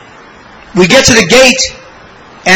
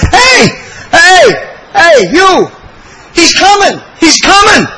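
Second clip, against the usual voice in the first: excited yelling; 8 semitones higher, slower than usual. Retold or imitated. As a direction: shout across the gate, thrilled. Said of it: "He's screaming at me".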